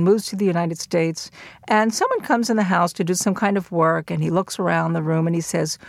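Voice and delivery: ordinary talk, nothing marked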